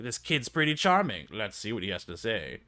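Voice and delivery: ordinary talk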